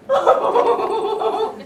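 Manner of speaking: giddy excited laughter; excited squeal. Shown as a demonstration. Teacher showing children a feeling to guess, excited. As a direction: burst of excited, gleeful noise. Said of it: "What am I feeling?".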